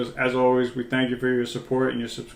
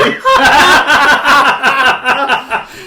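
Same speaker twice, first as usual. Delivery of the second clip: loud laugh; 12 semitones higher, 10 dB louder than usual. Real, not acted. Laughs at his own drawing.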